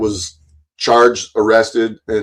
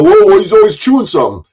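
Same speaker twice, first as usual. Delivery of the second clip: mock onlookers; 5 semitones higher, 4 dB louder, faster than usual. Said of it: "so everybody can go".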